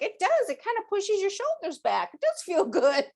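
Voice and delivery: high, lively delight; delighted surprise. Retold or imitated. Psychic telling how saying the swear word felt, delighted. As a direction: discover it feels good, shoulders back. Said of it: "And I'm like".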